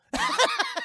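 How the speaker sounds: high burst of real laughter; a sudden loud laugh. Real, not acted. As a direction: crack up at the joke. Streamer laughing at his own bit.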